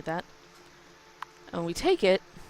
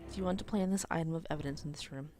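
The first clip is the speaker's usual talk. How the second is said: ordinary talk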